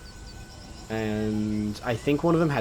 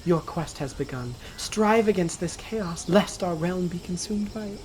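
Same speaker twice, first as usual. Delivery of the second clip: high-pitched, formal queen voice; a queen's charge in a put-on female voice; 7 semitones higher than usual. Queen sending heroes on their quest, female voice. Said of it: "reading it in a female voice".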